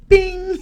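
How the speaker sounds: loud, very high mouth-made bing; imitated register ding. Storyteller showing how fast he opened the register, loud imitated ding. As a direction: ring out like a till popping open.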